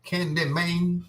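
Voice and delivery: high, slow put-on voice drawing out Candy Mane; silly voice. Closing the bit for listeners, slow high silly voice. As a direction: stretch the name slow and high. Playful repeated movie name.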